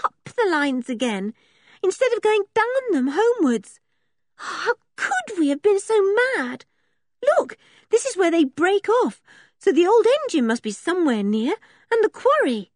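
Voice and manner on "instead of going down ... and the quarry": put-on high, agitated girl's voice; high-pitched and exasperated with herself; dismayed. A narrator's reading. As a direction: exclaim in dismay at being lost. Girl dismayed at being lost.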